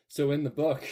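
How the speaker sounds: ordinary talk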